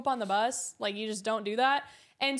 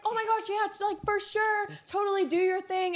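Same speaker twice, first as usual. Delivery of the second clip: bubbly fan voice; 7 semitones higher than usual. Retold or imitated. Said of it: "she was like".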